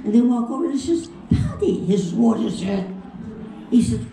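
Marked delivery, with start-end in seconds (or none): 1.3-1.8 put-on high Irish-accented call. Calling to a friend at the bar, Irish accent. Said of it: "this is over in Ireland". 2.1-2.8 tipsy Irish growl of an answer; drinking Irishman. Retold or imitated. Paddy interrupted while drinking, boozy Irishman. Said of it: "drinking them up pretty good".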